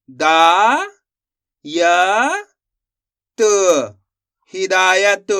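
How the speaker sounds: loud, slow syllable-by-syllable reading, then the whole word. Shown as a demonstration. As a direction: sound out each syllable clearly for learners, then join them. Teacher sounds out Hindi words. (एक-एक अक्षर ज़ोर से और धीरे पढ़ना)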